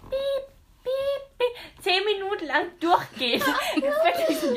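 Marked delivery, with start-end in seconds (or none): 0.1-1.8 hohes Piepen, nachgeahmt; Piepgeräusch nachgemacht. Retold or imitated. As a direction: piepe wie ein Gerät. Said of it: "solche Geräusche gemacht". (hohes nachgemachtes Piepen)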